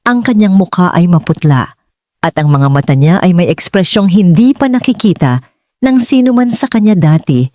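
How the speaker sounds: plain reading voice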